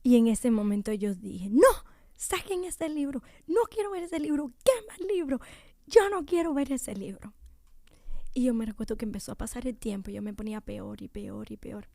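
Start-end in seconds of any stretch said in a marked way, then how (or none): 1.5-7.3 higher, upset retold protest; mock upset refusal of the book; angry refusal. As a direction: push the book away in distress.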